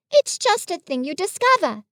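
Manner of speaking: put-on high, offhand boy's reply; careless. A narrator's reading. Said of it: "said Christopher Robin carelessly".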